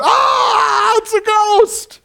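high, loud, hammed-up scream of terror; drawn-out panicked shriek, played up; crying out in fear. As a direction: scream in mock panic at a ghost. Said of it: "They cried out".

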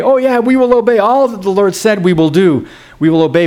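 high-pitched eager pledge, easing down; mock the people's too-ready promise; glib eager agreement. The people answering God with easy promises, glibly eager. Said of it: "Remember the people respond that way?".

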